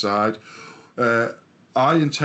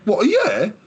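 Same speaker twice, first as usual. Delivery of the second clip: mock the colleague's shock; surprised colleague; 11 semitones higher than usual. Colleague hearing about booking a year ahead, high surprised question.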